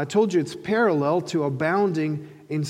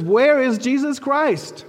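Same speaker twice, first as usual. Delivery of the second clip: higher, louder, slow and clear; louder and clearer; 8 semitones higher, 6 dB louder, slower than usual. Retold or imitated. Muhammad repeating to the confused guide, louder and clearer. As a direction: repeat it loud and clear so he can't miss it. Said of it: "says more loudly, more clearly".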